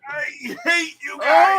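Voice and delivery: high, loud anguished yell; pained shout. Real, not acted. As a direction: yell it out in burning pain. Burning from a super-hot dab.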